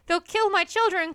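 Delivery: high, loud frightened cry. A narrator's reading. Missus Fox crying out to her husband in fear. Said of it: "cried Missus Fox".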